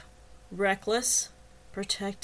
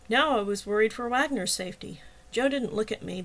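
ordinary delivery

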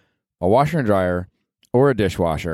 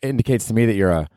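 usual speaking voice

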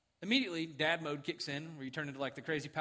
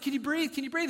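raised, louder urgent questioning; acted parental alarm; urgent worry; 10 semitones higher, 6 dB louder than usual. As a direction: check on a stung child urgently. Retold worried dad checking stung son.

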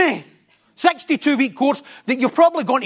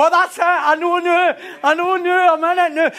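mock boast; 6 semitones higher than usual. Preacher mimicking a congregant, cocky and raised. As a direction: act out a smug I-know-it-now. Voicing listeners' overconfident boast.

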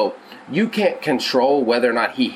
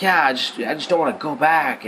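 put-on sulky kid; 5 semitones higher than usual. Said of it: "and he's like".

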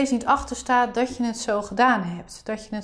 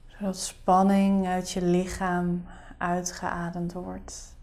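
soft spa whisper; 3 semitones lower, 9 dB quieter than usual. Acted. Leads a guided meditation.

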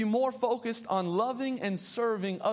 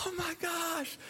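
outward delight; 8 semitones higher, 6 dB quieter, slower than usual. Retold or imitated. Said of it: "on the outside, I'm like".

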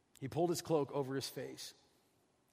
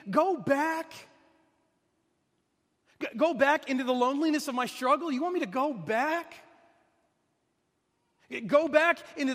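much higher and louder, incredulous; anguished protest; 9 semitones higher, 6 dB louder than usual. Acted. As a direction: throw the words back in disbelief. Elijah protesting God's command.

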